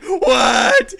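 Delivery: retold shriek of disbelief, high and loud; shocked disbelief. Retold shocked reaction to intercom news.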